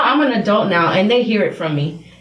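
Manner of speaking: normal talking voice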